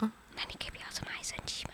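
very quick hushed whisper; barely audible, rapid comeback; whispered retort. Real, not acted. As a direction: whisper it back fast under your breath. Child whispers a retort to mother.

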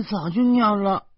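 put-on high, laughing little boy; sheepish child. Nephew admitting to his uncle, high sheepish child voice. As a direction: answer shyly like a small boy. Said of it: "豆豆缩着脖子说".

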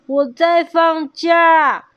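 high, slow storybook shout; loud announcement. A narrator's reading. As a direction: shout it out slowly as the crocodile. 鳄鱼大声宣布放假. (拉长声音大声宣布)

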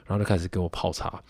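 normal talking voice

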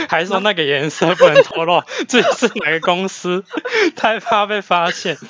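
talking through laughter; giggling while speaking; laughing. Real, not acted. Joking about uniforms, laughing.

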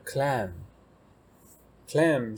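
vocabulary teacher models claim very slowly; slow model word. Shown as a demonstration. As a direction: claim drawn out slowly, said twice. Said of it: "那下一个claim".

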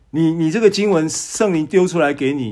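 ordinary delivery

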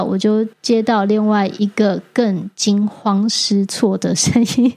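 normal talking voice

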